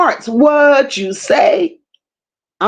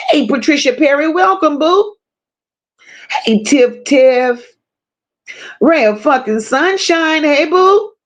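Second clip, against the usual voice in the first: usual speaking voice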